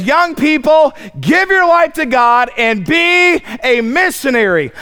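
a hint of a preacher's call to the youth; the missionary urging young people, lightly mocked; mock missionary appeal. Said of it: "he stops and says".

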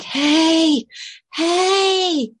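hushed but urgent high call; whisper yell. Retold or imitated. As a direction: call out without waking the house. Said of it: "the whisper yell".

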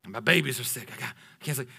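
low, hushed voice of the exhausted wife; wife tells husband she can't sleep, low and hushed; quiet worn-out wife. Retold or imitated. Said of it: "she goes".

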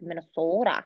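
lightly mocked Minnesota accent; Midwestern accent. Shown as a demonstration. Showing accents out west, mock Minnesota accent. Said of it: "Yeah, the Midwesterners".

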